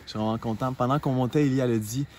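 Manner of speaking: low calm narrator voice; vlog narration. Acted.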